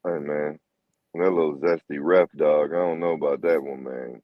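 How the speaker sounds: lowering the voice to tease the ref; mock deep voice. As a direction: drop into a deep, doubtful bro voice. Joking about the zesty ref.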